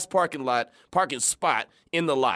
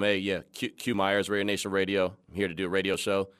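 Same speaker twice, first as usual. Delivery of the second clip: trying to sound cool; 6 semitones lower, 4 dB quieter than usual. Retold or imitated. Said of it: "Trying to be all cool".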